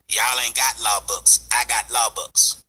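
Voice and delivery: low, slow mock boast; mocking rival. Vlogger mimicking rival's smug boast to viewers, low and slow. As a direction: drag it out like a smug know-it-all. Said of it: "talking to y'all people talking about some".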